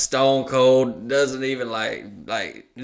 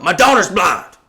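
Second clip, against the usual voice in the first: higher and much louder, full mock outrage; retold furious shout; 7 semitones higher, 9 dB louder than usual. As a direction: shout it like an offended father. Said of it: "He's like".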